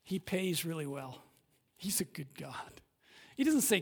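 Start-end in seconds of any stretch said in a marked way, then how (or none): 1.8-2.8 hushed whisper, much softer; a soft confiding whisper. Real, not acted. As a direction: lean in and whisper it. Intimate aside in sermon.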